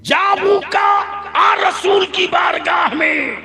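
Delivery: high, slow ringing shout; preaching at full force. Real, not acted. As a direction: call out to the crowd, soaring. Preacher leads loud call. (पूरे जोश से तक़रीर)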